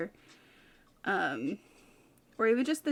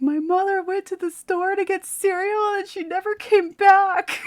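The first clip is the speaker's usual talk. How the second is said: Steven's character; 11 semitones higher, 10 dB louder than usual. Retold or imitated. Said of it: "Steven's character is always like".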